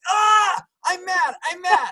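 high, loud hammed-up fury; mock angry shout. Driver to himself after being cut off, hammed-up shout. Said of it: "I'm like".

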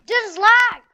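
frustrated shout. Real, not acted. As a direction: shout at the screen. Gamer raging at lag.

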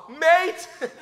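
high, loud call across the street; shouted greeting. Retold or imitated. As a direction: yell out to a friend passing by. Calling out to passing friend. (råbt hilsen)

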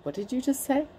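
hushed mock threat, barely above a murmur; calm menace.

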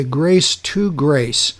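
"grace to grace" drawn out slowly, leaning on "to"; slow it right down to show the true sense; slow emphatic reading. Shown as a demonstration. A teacher showing his class the correct reading, slow and deliberate. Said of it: "it should read this way".

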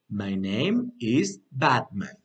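slow, low Batman answer. Shown as a demonstration. Teacher modelling Batman's answer, slow and low. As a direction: give the reply slowly and low for learners. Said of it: "un poquito más fluido".

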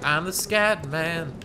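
slow, stiff robotic drone. Retold or imitated. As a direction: mimic a text-to-speech robot. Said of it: "please have robot voice".

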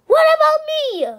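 high, loud indignant cry; jealous outburst. Acted. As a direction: squeal it out indignant.

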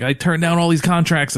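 high-pitched wrestler promo imitation; Owen Hart. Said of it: "'Cause he's like".

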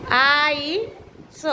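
આઈસ very slowly as "aa-ee", pause, "sa"; sounded out. Shown as a demonstration. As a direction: stretch the vowels of આઈસ and detach the final sound. Teacher sounds out word for writing.

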